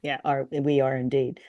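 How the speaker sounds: ordinary talk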